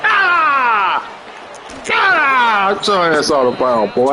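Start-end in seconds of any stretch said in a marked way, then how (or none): 0.0-2.8 long high-pitched "aah" yells; excited yell. Real, not acted. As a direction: let out drawn-out high hollers. Yelling at a game play.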